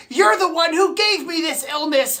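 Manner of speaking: normal talking voice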